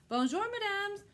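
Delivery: courtly high-pitched hello; polite lion greeting. A narrator's reading. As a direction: lilt it gallantly, up high. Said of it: "the happy lion said".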